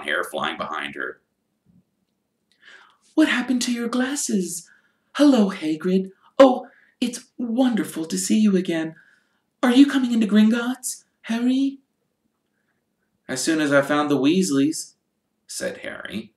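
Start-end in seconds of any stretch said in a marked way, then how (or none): 3.1-11.8 put-on high bright-sounding girl; eager young girl, higher; girl's voice. Hermione voiced by narrator. 13.3-14.9 lightly voiced young boy; a hint of a polite schoolboy; boy's voice. Harry voiced.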